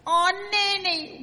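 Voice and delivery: put-on high wailing children's cry. Children wailing to Nanny at the lost rainbow. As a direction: wail it like upset kids. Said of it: "we wailed".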